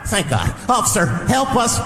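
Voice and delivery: high frantic mock shouting for help; panicked bystander. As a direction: beg the officer, high and panicky. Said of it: "Somebody might run up on me".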